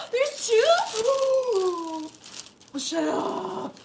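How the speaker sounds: high, loud there's two; surprised. Real, not acted. Finding a second gift, high loud surprise. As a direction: squeal in disbelief.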